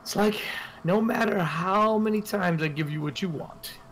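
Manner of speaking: high-pitched brothel madam teasing a regular; Mistress Lillian. Acted. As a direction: lift the voice up high and needle him playfully. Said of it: "She goes".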